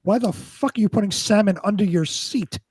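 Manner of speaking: ordinary talk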